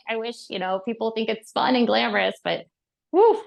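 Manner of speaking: ordinary talk, nothing marked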